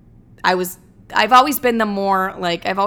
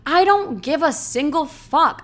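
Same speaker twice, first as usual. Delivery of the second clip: upset retort; 7 semitones higher, slower than usual. Retold or imitated. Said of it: "And I got so upset".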